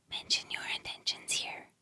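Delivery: very soft whispered prompt; hushed aside. Real, not acted. Inviting listeners to mention their intentions, hushed whisper. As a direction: whisper it as an aside within prayer.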